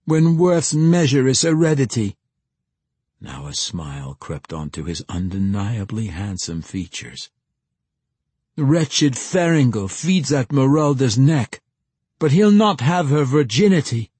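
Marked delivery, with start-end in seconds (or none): 0.0-2.2 high, loud declaimed verse; raging recitation. A narrator's reading. Young man reciting his bitter verse, raging. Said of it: "his voice quivering with rage". 8.6-14.1 high, loud, defiant declamation; angry verse. A narrator's reading. Young man finishing his verse against his rival, angry. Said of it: "Anger replaced self-pity".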